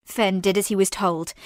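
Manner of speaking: ordinary delivery, nothing marked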